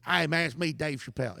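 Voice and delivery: mock the comedian with a cheesy greeting; lightly voiced, deliberately bad impression; bad Dave Chappelle impression. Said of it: "it's a terrible impression".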